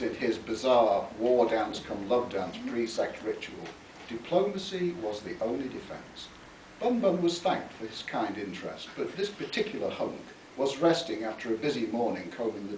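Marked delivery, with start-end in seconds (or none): none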